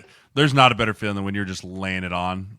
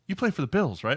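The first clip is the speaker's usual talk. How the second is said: much higher, lightly voiced curious woman; passenger asking; 7 semitones higher than usual. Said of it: "she turns, she goes".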